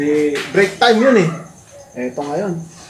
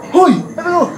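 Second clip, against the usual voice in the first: high, brave taunt; mock challenge; 8 semitones higher than usual. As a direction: square up to the bully. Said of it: "Nakakuha ng lakas". (matapang na hamon)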